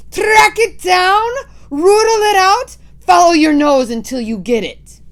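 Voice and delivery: high, loud witch order; screaming witch. Acted.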